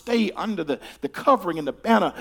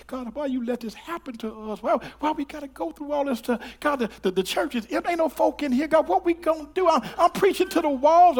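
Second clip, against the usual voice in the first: high, pleading cry to God; mock lament to God; 11 semitones higher than usual. Preacher retelling his covid complaints to God. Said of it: "I'm like".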